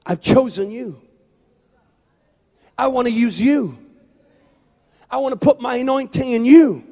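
high, forceful divine declaration; voice of the Lord. Acted. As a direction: build into a loud, preached commission from God. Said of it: "I hear the voice of the Lord reply back to you".

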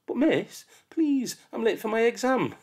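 put-on pleading child. Late child begs the teacher, pleading. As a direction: plead desperately.

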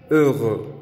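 "heureux" with rounded E sounds; French heureux. Shown as a demonstration. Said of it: "que se dice".

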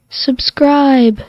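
loud recorded playback; sample played back; playback sound. Shown as a demonstration. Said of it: "when I press that button".

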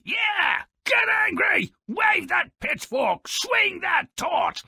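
high excited mob-rousing yells; rabble-rousing shouts. Acted. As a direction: cheer the villagers on with a pitchfork. Host eggs on angry villagers.

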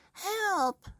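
very high, shrill cry; high cartoon scream from Mrs Twit; screaming for help. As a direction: scream as she shoots skyward. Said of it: "she screamed".

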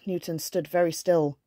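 usual speaking voice

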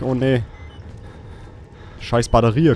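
ordinary talk, nothing marked

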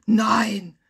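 loud, scandalized cry; indignant exclamation. A narrator's reading. Lounger shocked to hear of the robbery, indignant. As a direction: burst out in outrage. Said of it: "rief Liege fünf entrüstet".

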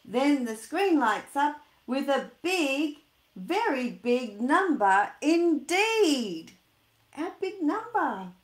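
dramatic storybook reveal; building excitement. Acted. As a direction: draw out the huge number with awe.

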